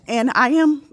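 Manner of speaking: holding back tears; teary-eyed. Real, not acted. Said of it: "sorry, I get a little teary-eyed".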